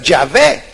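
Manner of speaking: Yahvé pronounced yah-VEH, stress on the final VÉ; careful pronunciation. Shown as a demonstration. Preacher giving the scholars' pronunciation Yahvé, careful pronunciation. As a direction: set Yahvé apart as the correct form, clearly voiced. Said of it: "los eruditos que dicen que debe ser".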